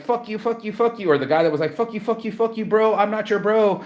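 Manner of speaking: high, hammed-up fuck-you rant; mock yelling customer, high and jeering; mock angry customer. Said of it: "the girl that was like".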